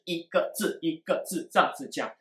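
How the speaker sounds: each syllable of "一个字一个字这样子讲" cut apart; word by word. Shown as a demonstration. Teacher showing how not to speak, every character separated. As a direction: break "一个字一个字这样子讲" into separate beats.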